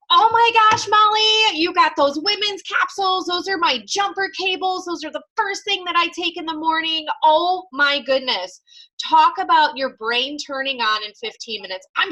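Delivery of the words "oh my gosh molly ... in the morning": much higher and louder, bubbling over with enthusiasm; gushing excited sponsor. Acted. Sponsor hyping up a new recruit on her live video, gushing. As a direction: squeal it like you can't contain yourself. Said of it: "And I, her sponsor, am like".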